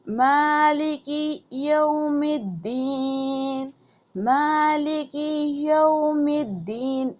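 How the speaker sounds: slow melodic Maliki यौमिद्दीन; Quran recitation. Shown as a demonstration. Teacher reciting for students, slow and melodic. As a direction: chant the verse slowly.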